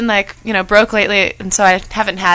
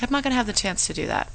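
quiet; 11 dB quieter, faster than usual. Retold or imitated. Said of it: "I was like".

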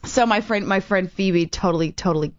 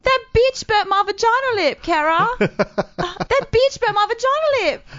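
high-pitched pained Aussie whine; [name], Australian accent; 13 semitones higher, slower than usual.

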